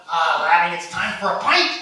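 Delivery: loud, hammed-up caddy call; caddy's voice. Caddy to his golfer in Scotland, loud put-on voice. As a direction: boom it out as the jolly caddy. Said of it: "My caddy's going".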